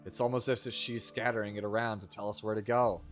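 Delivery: bad acting. Reads game dialogue aloud.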